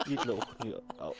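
laughing though it hurts; laughing and wincing in pain; pain through laughter. Real, not acted. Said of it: "Es tut weh". (lachen trotz Schmerzen)

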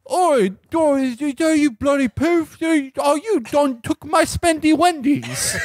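high, hammed-up Cockney accent, drawn out; mock Cockney. Comedy British bloke mocking the hero in hammed-up Cockney. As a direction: ham up a Cockney geezer. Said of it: "And they're like".

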